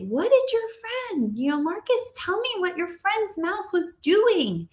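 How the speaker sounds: teacher questioning. Shown as a demonstration.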